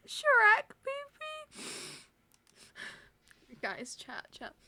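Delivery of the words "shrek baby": high, squealing through laughter; laughing. Real, not acted. Streamer reacting to chat name, laughing. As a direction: cry it out while laughing.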